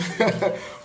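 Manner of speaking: soft, brief laugh; short chuckle. Real, not acted. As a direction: chuckle lightly at your own joke. Chuckles at own small joke.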